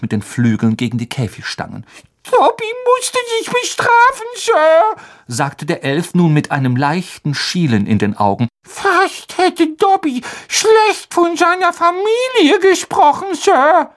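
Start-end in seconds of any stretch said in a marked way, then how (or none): 2.3-5.0 very high squeaky elf voice, hesitant; a timid little elf struggling for words; nervous house-elf. A narrator's reading. 8.7-13.9 very high squeaky elf voice, hesitant; a timid little elf struggling for words; nervous house-elf. A narrator's reading.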